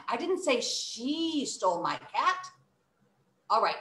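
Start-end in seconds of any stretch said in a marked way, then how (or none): 0.1-2.5 "she" stretched out; stress on SHE. Shown as a demonstration. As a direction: hold the word "she". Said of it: "It's really identifying".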